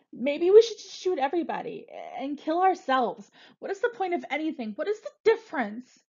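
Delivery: rising, near-tears outburst; desperate yell. Acted. Frosty yells at the hostages, voice rising desperately. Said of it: "Frosty yells".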